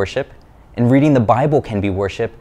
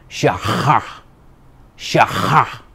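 "Shaha" with a guttural Hebrew ch, toothpaste mouth; hammed-up Hebrew accent on "Shaha", throaty h; much slower than usual. Shown as a demonstration.

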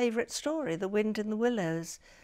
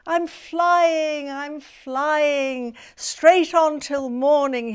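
joyful shout, higher and louder; 5 semitones higher, 9 dB louder, much slower than usual. Acted. Ernest flying with Peter and Wendy, shouting with joy. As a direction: cry out in joy while flying. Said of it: "he shouted".

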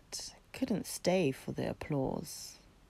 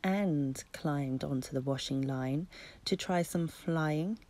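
plain reading voice

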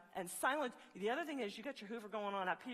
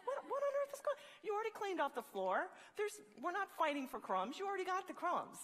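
high-pitched comic protest; hammed-up bewildered complaint; mock exasperation; 7 semitones higher than usual. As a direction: squeal in baffled indignation, then keep arguing up high. Voices exasperation at vacuum frenzy.

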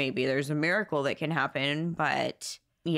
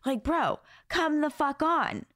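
exasperated outburst; 9 semitones higher than usual. Real, not acted. Venting about partner.

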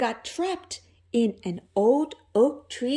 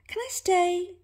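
higher mouse voice, hopeful; 9 semitones higher than usual. Acted. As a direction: ask like a shy little mouse. Mouse asks to stay.

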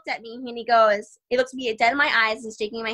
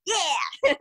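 high, rising victorious yeah; triumphant yeah; 7 semitones higher than usual. Retold or imitated. Young fighter recalling her win, gleeful yeah. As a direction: savor the win in one word. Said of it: "and I was like".

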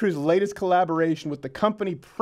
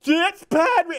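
high mock yell, cut short; dad yelling again; 12 semitones higher, 5 dB louder, slower than usual. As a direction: shout it once more at the screen. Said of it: "he just kept doing it".

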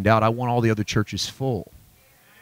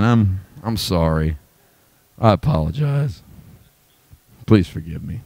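mock apology; 5 semitones lower than usual. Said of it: "I would, but I'm not really".

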